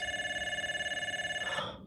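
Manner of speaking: startled breathless gasp; sharp gasp. Real, not acted. Startled by a phone she forgot, sharp gasp. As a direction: gasp in sudden fright.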